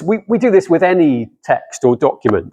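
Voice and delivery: ordinary talk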